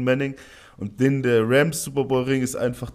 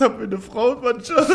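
ordinary delivery

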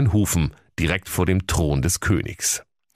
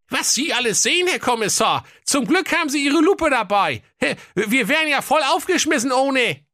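very high, squeaky king voice; a silly old king, pitched way up; comic king voice. Acted. (hohe quäkige Königsstimme)

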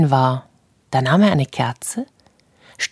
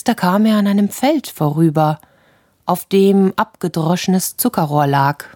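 usual speaking voice

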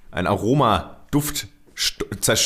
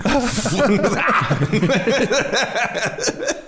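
laugh; 9 semitones higher than usual. Real, not acted. Laughing at own story.

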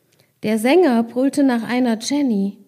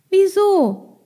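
put-on child voice; 8 semitones higher, 4 dB louder than usual. Child asks why.

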